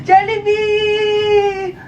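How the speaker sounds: slow sung crying wail; child wailing for Jollibee in a drawn-out fake cry; mock wailing. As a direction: drag out the name in a fake sob.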